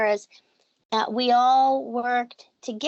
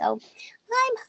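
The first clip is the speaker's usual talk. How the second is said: put-on tiny high, slow baby animal whimper; high little kitten voice; 7 semitones higher, slower than usual. Shown as a demonstration. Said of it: "you can do the kitten's voice".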